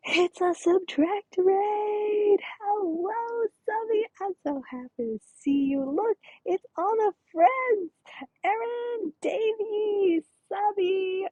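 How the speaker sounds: high, thrilled welcome to arriving friends; excited greeting. Real, not acted. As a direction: squeal hellos to the raid. Streamer greets incoming raid.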